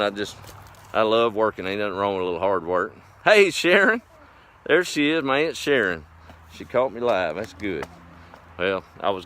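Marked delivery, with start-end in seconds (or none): 3.2-6.1 high, loud delighted hello to his aunt; light up as you greet her; excited greeting. Real, not acted. Greets aunt joining live stream.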